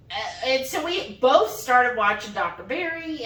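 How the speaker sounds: normal talking voice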